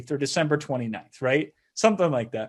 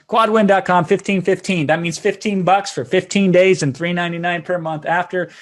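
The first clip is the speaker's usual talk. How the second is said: louder punchy ad read for the sign-up offer; promo read; 2 semitones higher, 6 dB louder than usual. Acted. Host plugging the offer to listeners, loud promo read. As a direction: sell the deal like a radio spot.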